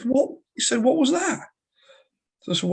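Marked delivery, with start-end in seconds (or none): 0.0-1.5 bewildered. Retold or imitated. Client confused waking.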